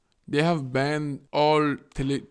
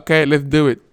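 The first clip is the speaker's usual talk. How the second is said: louder satisfied okay; hammed-up louder deal-making; mock approval; 12 dB louder than usual. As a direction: close the deal loudly. President agrees in mock scene.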